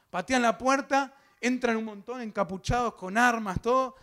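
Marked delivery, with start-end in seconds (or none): none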